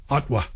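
"Ottawa" in two syllables, like OT-wa; dropped middle vowel. Shown as a demonstration. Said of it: "people leave out that extra vowel".

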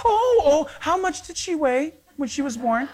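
high, gushing coo over a newborn; sweetly gushing stranger asking about the birth weight; cooing woman. Retold or imitated. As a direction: melt over the baby like a doting passenger.

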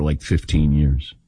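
ordinary talk, nothing marked

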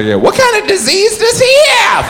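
high, nosy stranger voice; gossipy onlooker asking; nosy bystander. Retold or imitated. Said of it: "People always say".

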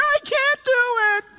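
very high, drawn-out mock wail; hammed-up helpless protest; whining Moses. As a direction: whine it slowly in a high put-on voice. Moses pleading with God.

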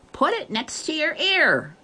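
put-on high, far-off child's call; little girl shouting. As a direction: call out the instruction. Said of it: "the little girl's shout came again".

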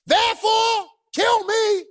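hammed-up death wish; despairing Jonah. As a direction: cry it out like a fed-up prophet. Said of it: "That's what he says in verse three".

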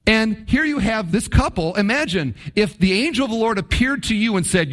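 ordinary talk, nothing marked

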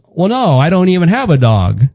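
high, deadpan dad voice; imitating dad. Son imitating his dad admitting he has no dog, high and deadpan. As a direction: deliver the punchline straight.

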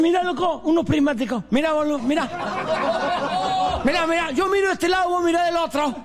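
high-pitched, amazed joke character; excited discovery. Acted. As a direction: pipe up high, thrilled with the find. Acted drunk finds supposed binoculars. (asombro exagerado, voz aguda)

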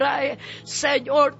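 ordinary delivery

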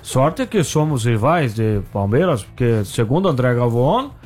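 slow, low mock-Seedorf voice; Sidorf. Fake guest needling his colleague Galvão, slow low Seedorf impression. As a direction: drag the words out as a fake footballer, pitched right down. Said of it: "Sua imitação foi ridícula".

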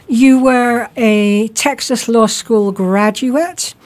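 ordinary delivery

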